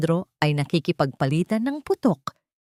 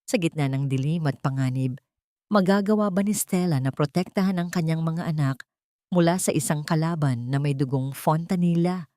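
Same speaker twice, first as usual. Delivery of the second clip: usual speaking voice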